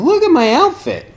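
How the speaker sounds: high, loud mock boast; playful showing off. Speaker mimicking own vanity in a Zendo, playful showing off.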